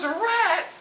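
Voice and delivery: a rat?! shot up high in alarm; shocked shriek. Retold or imitated. Wife woken at night, hearing about the rat, shrieks in alarm.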